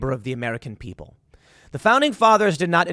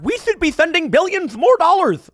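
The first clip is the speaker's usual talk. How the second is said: mock eager pundit, much higher and louder; mocking Twitterati; 12 semitones higher, 6 dB louder than usual. Blue check Twitterati calling for more money, mocking high voice. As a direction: ham up a smug online cheerleader. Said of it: "being like".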